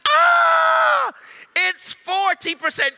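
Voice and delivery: high, loud shrieking spirit voice; shrieking demon. Retold or imitated. Spirit reports the healing to the minister, high shriek. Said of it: "demon reports progress".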